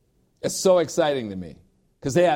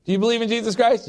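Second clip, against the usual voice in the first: high and fast, rattled off; hurried eager asking; rushed mock quiz; 7 semitones higher, much faster than usual. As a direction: fire the question off eagerly. Quick mock quiz of partner.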